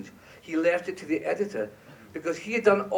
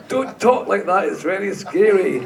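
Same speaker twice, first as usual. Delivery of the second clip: laughing; 4 semitones higher, 7 dB louder than usual. Real, not acted. Laughing while telling the story.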